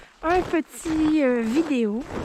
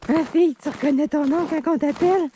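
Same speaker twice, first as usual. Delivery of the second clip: talking to a dog. Real, not acted. Talking sweetly to her dog.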